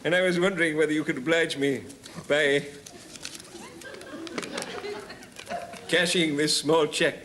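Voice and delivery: hammy actor buttering up a shopkeeper; old touring actor. Acted. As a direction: project grandly like an old thespian. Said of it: "a story about an old boy who's on tour".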